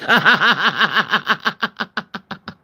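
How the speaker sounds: long, rattling run of laughter. Real, not acted. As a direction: laugh on and on at your own joke. Laughing at own joke.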